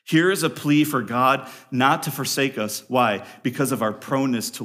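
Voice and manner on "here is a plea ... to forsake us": ordinary delivery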